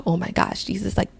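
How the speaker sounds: hushed, breathless whisper of wonder; stunned, whispered aside; awed whisper. Retold or imitated. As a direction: breathe it out in disbelief. Awed realization about driver's name.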